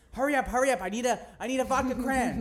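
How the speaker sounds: frantic mock begging for a drink; urgent retold plea. Friend pleading for a vodka cran, urgent.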